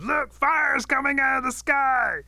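high, drawn-out yell of warning; alarmed shout. Acted. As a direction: shout the alarm at full volume. Rabbit yelling a false alarm.

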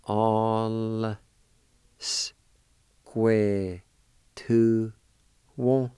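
"All square to one" spoken word by word, each word set apart; careful pronunciation demo. Shown as a demonstration. Teacher sounding out "All square to one" for students, slow and deliberate. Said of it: "you can pronounce the L sound with the A like this".

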